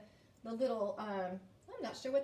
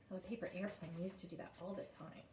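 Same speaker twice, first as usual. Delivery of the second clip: soft, low murmured aside; quiet aside; 5 semitones lower, 10 dB quieter than usual. Real, not acted. Teacher recalls paper airplanes, soft low aside. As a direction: drop right down, as if musing aloud.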